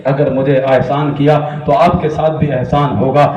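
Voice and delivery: usual speaking voice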